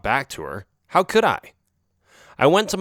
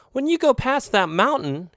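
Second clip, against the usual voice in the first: slightly higher, knowing alley cat; lightly voiced friend cat; 13 semitones higher than usual. A narrator's reading. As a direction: warn him off casually. Narrator voices Oreo.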